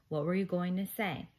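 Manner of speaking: plain reading voice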